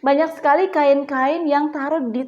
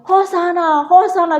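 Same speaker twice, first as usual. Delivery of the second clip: short raised cheers; higher-pitched acclaiming call; crowd shout; 5 semitones higher, 4 dB louder than usual. Acted. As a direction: cry it out like the crowd. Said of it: "Semua teriak".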